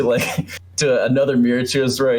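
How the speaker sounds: ordinary talk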